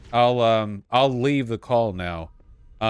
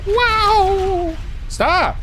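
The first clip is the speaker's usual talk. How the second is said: startled yelps; 3 dB louder than usual. Real, not acted. Game panic, high, loud.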